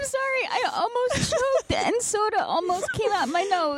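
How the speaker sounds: high and breathless from laughing; laughing fit. Real, not acted. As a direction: apologise while still cracking up. Laughing fit, nearly choked.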